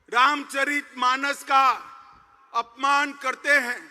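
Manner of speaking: ordinary delivery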